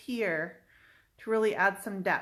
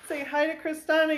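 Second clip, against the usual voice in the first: usual speaking voice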